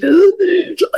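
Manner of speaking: a sharp high gasp for air; imitated gasp. Telling the host about breathing trouble on the climb, a quick mock gasp. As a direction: mime the breath catching mid-sentence.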